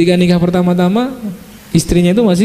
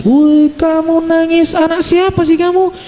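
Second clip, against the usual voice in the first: mock mother scolding; 12 semitones higher than usual. Mother scolding crying child.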